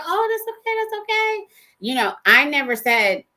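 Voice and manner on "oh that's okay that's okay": high, sweet brushing-off voice, much higher pitch; overly polite reassurance. Retold or imitated. Telling the church lady it's fine, in a high sugary voice. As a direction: sing it up high like it's no trouble at all. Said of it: "And I was like".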